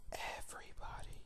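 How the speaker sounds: hushed, breathy whisper for emphasis; whispered aside. Real, not acted. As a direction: drop to a whisper as if sharing a secret.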